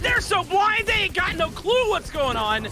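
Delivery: fast, high, hyped-up casting; excited play-by-play. Acted. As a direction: call the action like it's the big moment. Hyped live play-by-play call.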